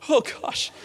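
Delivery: high, breathless laugh of surprise; emotional laugh. Real, not acted. Dad touched by his son's goodbye, laughing high.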